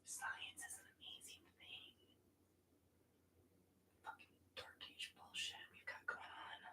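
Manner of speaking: under-the-breath muttered whisper; whispered aside. Real, not acted. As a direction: whisper it to yourself. Muttered aside during yoga.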